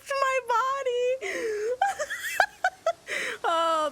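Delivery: high, slow laugh-sobbing words; crying with laughter. Real, not acted. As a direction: wheeze the words through tears of laughter. Laughing at her own mugging.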